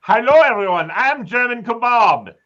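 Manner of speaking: high, slow mock German accent; hammed-up German accent host voice. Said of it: "You can put your German accent on".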